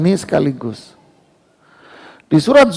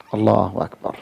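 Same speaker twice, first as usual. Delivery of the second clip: usual speaking voice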